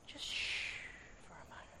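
hushed whisper of God. Retold or imitated. God hushing the anxious preacher in a whisper. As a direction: breathe it, barely audible. God speaking softly in prayer.

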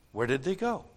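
usual speaking voice

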